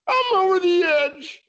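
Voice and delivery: drawn-out hammed-up boast; voicing the birthday girl.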